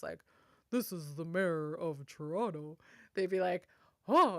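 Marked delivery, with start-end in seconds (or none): 0.7-2.8 disguised mayor voice. Retold or imitated. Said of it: "tried to disguise her voice". 4.1-4.4 high, loud mock surprise; mock surprised. Hospital staff playing along with a child's prank call. Staff greeting the pretend mayor.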